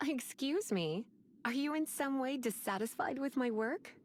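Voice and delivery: ordinary talk, nothing marked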